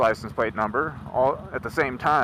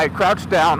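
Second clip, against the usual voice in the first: usual speaking voice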